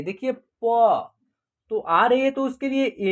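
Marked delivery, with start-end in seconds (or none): 0.0-1.1 प said slowly to show its अ sound; slow sound demo. Shown as a demonstration. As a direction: draw out प so the अ sound is heard. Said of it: "उसमें अ की sound".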